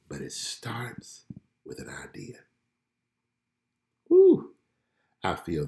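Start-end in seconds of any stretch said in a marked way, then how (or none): 0.1-2.4 whisper. Real, not acted. Dropping to a hush for the key line. 4.1-4.5 much higher and louder whoop; joyful whoop. Real, not acted. Reacting to her own point.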